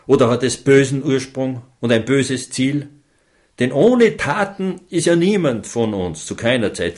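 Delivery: ordinary delivery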